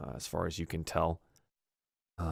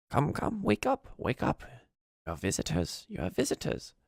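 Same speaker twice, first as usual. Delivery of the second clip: put-on high, coaxing old goblin voice; high-pitched goblin crone waking someone; old goblin, softly; 9 semitones higher than usual. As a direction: gently rouse a sleeper as an old goblin. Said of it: "seems to be talking to".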